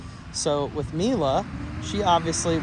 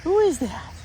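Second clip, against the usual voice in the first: much higher, sing-song cooing; 3 dB louder than usual. Real, not acted. Cooing to dog.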